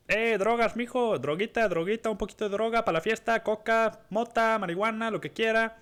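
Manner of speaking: high, loud, rapid-fire Spanish sales patter; bathroom drug peddler. Retold or imitated. Seller on the sink offers party drugs, high hawking voice. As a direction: imitate a street seller's high-pitched singsong pitch.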